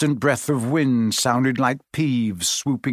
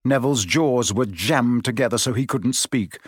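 ordinary delivery, nothing marked

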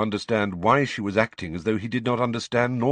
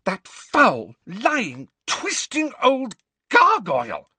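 high, loud, explosive outrage; storming fury; 5 dB louder, slower than usual. A narrator's reading. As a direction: hurl each insult separately, furiously. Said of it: "stormed Hermione".

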